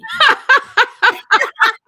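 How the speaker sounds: loud high laugh; real delighted laughter; high rising laugh that bursts out. Real, not acted. Laughing at doctor's pitch.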